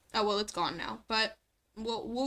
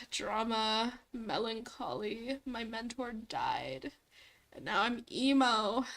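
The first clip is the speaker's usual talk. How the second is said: mopey emo voice; slower than usual. Retold or imitated. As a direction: play the brooding edgelord, hammed-up. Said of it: "the D and D character".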